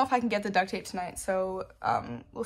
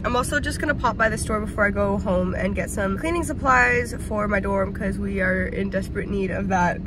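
ordinary talk

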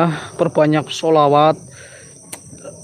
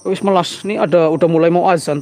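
ordinary delivery

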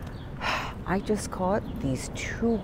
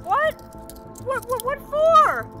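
shrill shock; 4 dB louder than usual. Acted. Shocked at arrest.

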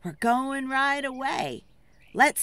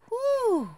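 1 relieved whew; 13 semitones higher than usual. Pretend. Relief after forest.

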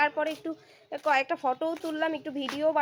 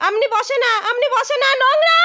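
loud, high-pitched scold; shrieked parental telling-off, rising higher; 12 semitones higher, 9 dB louder than usual. Real, not acted. Scolding child sitting in dirt.

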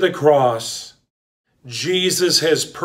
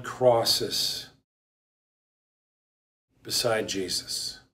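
solemn hush; 3 semitones lower, 5 dB quieter than usual. Acted. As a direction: let each phrase sink in quietly. Solemn reflective pause after reading.

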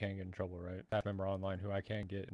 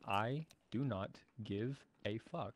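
slow, word-by-word statement; emphatically slow and distinct; clear, deliberate delivery; slower than usual. Real, not acted. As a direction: space out every word for clarity. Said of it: "I'll put this in clear verbal communication".

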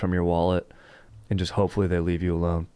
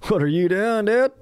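pitched way up, teasing; high mock voice; 11 semitones higher, 4 dB louder than usual. Teasing his co-host in a high silly voice. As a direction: squeak the question up high.

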